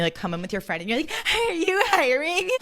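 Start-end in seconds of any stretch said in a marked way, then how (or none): 1.1-2.5 high, slow mock teen voice; giggly high schooler. High schooler with friend asking for work, giggly. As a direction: imitate a giddy teen job-seeker, way up high. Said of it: "you're in high school and you're applying".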